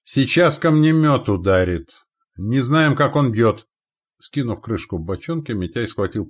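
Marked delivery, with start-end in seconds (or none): 0.0-3.7 loud, high alarm; urgent warning shout. A narrator's reading. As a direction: warn the whole crew of incoming stones. Warning of incoming stone thrower.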